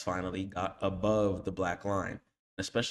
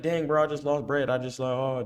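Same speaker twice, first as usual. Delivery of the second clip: higher and louder, a hammed-up whine over lost money; mock panicking trader; 6 semitones higher, 5 dB louder than usual. Coach mimics a trader panicking after a loss, mock whine. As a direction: play the student who just lost cash and is freaking out. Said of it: "Don't be like".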